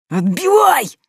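high desperate yell; desperate shout. A narrator's reading. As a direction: scream the order in panic. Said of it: "Отчаянно заорал Мик".

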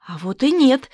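plain reading voice

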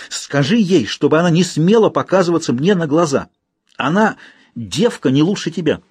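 высокий злой напор; выплюнуть от бешенства; в ярости. Acted. Княгиня в ярости. (княгиня в ярости гонит служанку)

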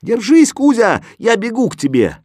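high, loud rallying shout; urgent call. A narrator's reading. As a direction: shout that you are coming to help. Boy calls to freezing cat.

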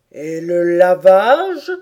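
slow, loud probing turtle; tortue qui interroge. A narrator's reading. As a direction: draw the question out heavily. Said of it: "fit la tortue". (tortue qui demande lentement, fort)

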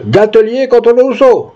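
high-pitched court call. A narrator's reading. Clerk calls two parties in court, calling out. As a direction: call the next case out across the room. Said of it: "cria-t-il".

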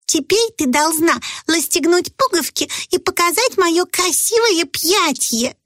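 put-on high, slow bossy child; instructing little girl. A narrator's reading. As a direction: prompt your partner on stage. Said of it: "проинструктировала Золушка".

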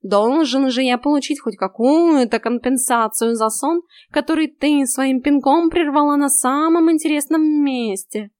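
the cat snorts back smugly; snorting cat. A narrator's reading. Cat Vaska voice.

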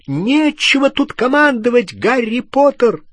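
very high, loud rebuke; furious high-pitched yell; angry shout. A narrator's reading. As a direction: scream at him to stop bossing. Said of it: "крикнула она".

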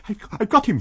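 high loud triumphant scream. A narrator's reading. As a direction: yell it out mid-struggle. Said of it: "screamed Kemp".

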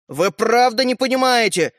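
angry. A narrator's reading. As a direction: scold them for not getting it. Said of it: "сердито отозвался мальчик с пальчик". (сердито)

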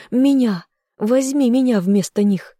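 high, loud desperate demand; fierce cry. A narrator's reading. Heroine offering herself in place of friends, fierce cry. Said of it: "яростно закричала я".

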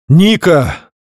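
loud call across a cave. Acted. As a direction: shout her name from afar. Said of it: "Голос любимого, отразившись эхом".

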